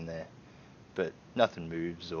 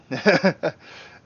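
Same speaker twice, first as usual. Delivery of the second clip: incredulous laugh; 8 semitones higher, 10 dB louder than usual. Acted. Homeowner reacts in character.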